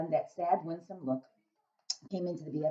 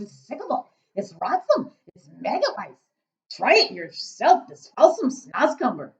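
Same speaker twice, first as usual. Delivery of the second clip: big loud giant, muddling his words; 6 semitones higher, 12 dB louder than usual. A narrator's reading. Giant grumbling to Sophie about snozcumbers, big booming voice. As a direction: boom it out as a kindly giant.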